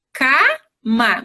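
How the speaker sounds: "cama" slowly split into "ca" and "ma", open first a; syllable by syllable. Shown as a demonstration. Teacher joining syllables for a child, "cama" slowly sounded out. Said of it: "o K do cachorro e o ma é do macaco".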